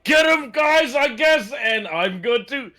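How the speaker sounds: very high, strained yelling; acted panic, squealing the words out high and drawn out; screamed in panic. As I'm screaming it out.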